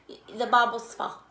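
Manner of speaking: ordinary talk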